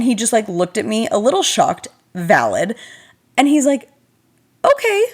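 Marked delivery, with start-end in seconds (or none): 4.6-5.0 surprised okay. Retold or imitated. Boyfriend's stunned reply.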